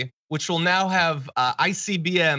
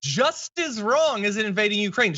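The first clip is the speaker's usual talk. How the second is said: higher, incredulous mock read of her words; mock incredulous quote; 7 semitones higher than usual. Host quoting a politician's claim, higher mock disbelief. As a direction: say her words as if they're absurd. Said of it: "in her words".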